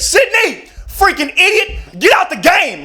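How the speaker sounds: high, loud furious screech; shrill courtside yell at the kid; coach yelling. Retold or imitated. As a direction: scream at the player like a raging coach. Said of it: "He was like".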